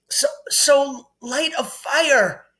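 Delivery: mock choking boy; choked. A narrator's reading. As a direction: force it out while choking. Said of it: "Harry choked".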